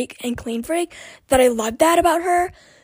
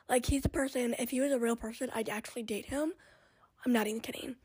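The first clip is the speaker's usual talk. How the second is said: much softer, confiding aside; hushed confession; 14 dB quieter than usual. Real, not acted. Confiding to viewers about her dog, very soft. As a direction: whisper it like a secret.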